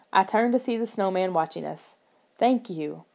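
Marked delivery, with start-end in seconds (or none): none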